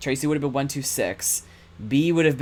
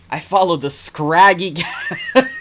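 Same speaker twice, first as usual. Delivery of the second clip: laughing; 6 semitones higher, 9 dB louder, slower than usual. Real, not acted. Streamer reading a silly word aloud, laughing through it. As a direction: read it slow and loud through laughter.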